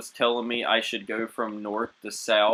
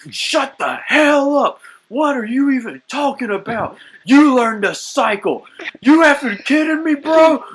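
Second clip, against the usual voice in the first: furious gamer yell; 11 semitones higher, 7 dB louder than usual. Retold or imitated. Raging at a teammate over the mic, screaming. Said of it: "I came through like".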